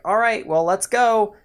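high, loud call to set off; game friend. Retold or imitated. Game friend urges the player along, high and loud. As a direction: call it out like an eager cutscene companion. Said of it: "then the friend's like".

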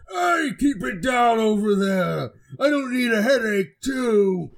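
high, drawn-out ogre shout; irritated ogre. Acted. Ogre yelling at noisy passengers across the hold, irritated. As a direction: holler it across the room, slowly. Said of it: "the ogre that's sitting on the other side of the room goes".

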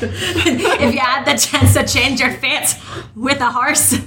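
slow, laughing mock Scottish accent. Cohost riffing on murder horses for the host, Scottish accent. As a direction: drag it out in playful Scottish. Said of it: "do my horribly offensive Scottish accent".

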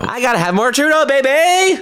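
put-on very high, hollered "baby" with a long A; over-the-top high cry, landing on the A of "baby"; heavy A in baby. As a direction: belt it out, stretching "baby" into "bay-bay". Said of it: "I say baby with a heavy emphasis on the A".

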